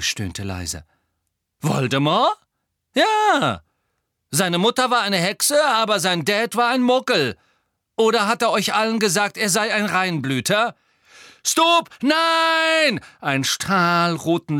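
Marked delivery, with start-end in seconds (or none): 1.6-10.7 high, loud, goading voice; reckless provocation. A narrator's reading. Harry keeps provoking the enemy. 11.4-13.0 very high, loud cry; urgent shout. A narrator's reading. Spell cast, urgent shout.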